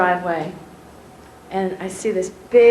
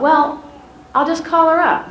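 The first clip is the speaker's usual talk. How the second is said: higher and louder, a mock eureka; sudden bright idea; 8 semitones higher, 4 dB louder than usual. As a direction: blurt the idea out lightly, pleased with yourself. Excited idea, retold thought.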